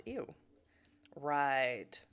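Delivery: ordinary talk, nothing marked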